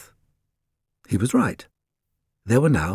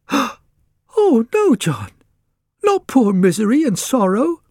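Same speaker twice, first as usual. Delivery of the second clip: high, louder wife's voice pleading; a wife begging to spare the pet geese; distressed wife; 12 semitones higher, 5 dB louder, slower than usual. Acted. Wife begs husband to spare the geese, high louder plea.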